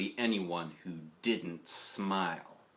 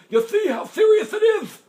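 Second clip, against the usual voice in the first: moaning toothless grandpa, much higher and louder; 13 dB louder than usual. Acted. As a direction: wail it like a desperate grandpa. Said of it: "moaned Grandpa".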